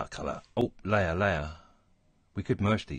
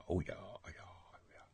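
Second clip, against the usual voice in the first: breathy, much softer whisper of approval; whispered oh yeah; 9 dB quieter than usual. Real, not acted. Pleased with the colours, whispering to himself. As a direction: let it fade to a whisper. Admiring own work under breath.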